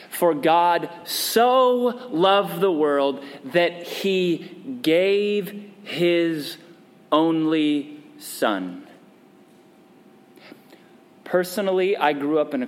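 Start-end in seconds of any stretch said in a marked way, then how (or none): 0.1-8.9 slow recitation. Real, not acted. Reciting scripture slowly.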